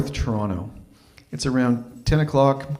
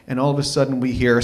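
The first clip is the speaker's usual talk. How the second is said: usual speaking voice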